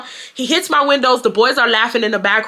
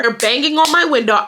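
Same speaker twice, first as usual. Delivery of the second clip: usual speaking voice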